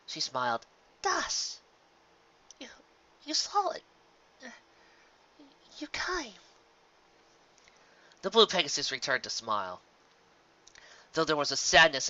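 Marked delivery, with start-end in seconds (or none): 1.0-6.3 high, soft, lightly voiced Rarity; a hint of tender relief; weak, grateful Rarity. Weak Rarity greets rescuer.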